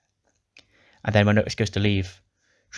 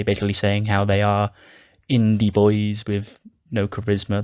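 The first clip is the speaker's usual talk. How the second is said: ordinary talk, nothing marked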